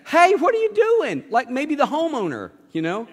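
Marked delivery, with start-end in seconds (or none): 0.1-1.2 high, loud, slow outraged shout; startled yell. Retold or imitated. Person in the room yelling up at the diggers. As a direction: yell up at the hole in the roof. Someone objects to roof being dug.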